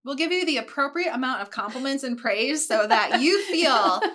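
laughing while talking; high giggly voice. Real, not acted. Laughing banter.

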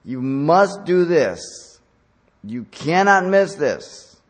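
slow, loud stern parent voice. Acted. Parent warning child about something important, slow and stern. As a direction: lay down the law to a child, word by word. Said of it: "when you deal with your child".

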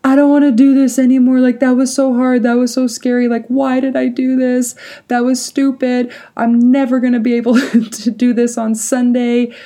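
high, fast retold wail, no real sobs; hammed-up distressed whine, pitched high; near-tears complaint. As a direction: pour it out in a mock-tearful rush. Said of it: "I'm, like, basically crying. I'm telling him".

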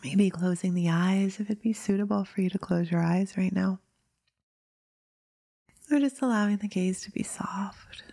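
drifting into whispers between long pauses; guided meditation. Acted. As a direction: ease listeners gently into stillness.

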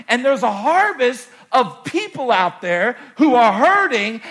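Jesus pressing the disciples, building loud; urgent plea. Acted. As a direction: sound the alarm. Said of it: "Jesus was trying to say".

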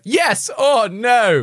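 high, slow, drawn-out ultimatum; mock demand. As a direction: draw out the ultimatum. Echoing Nick's ultimatum.

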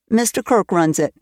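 usual speaking voice

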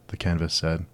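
ordinary delivery, nothing marked

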